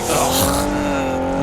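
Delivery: high, breathy groan of reluctance; disgusted groan. Retold or imitated. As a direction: groan like you can't be bothered. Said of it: "You're just like".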